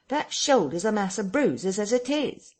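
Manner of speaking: put-upon vagrant complaining; Marvel's voice. A narrator's reading. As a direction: whine it as a bruised tramp.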